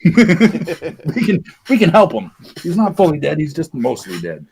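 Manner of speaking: put-on high, chuckling old healer voice; cheery quack doctor. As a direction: laugh through the cheerful sales pitch.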